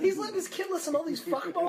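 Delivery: high laughing voice. Real, not acted. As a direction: laugh it out in disbelief. Laughing, mock outrage.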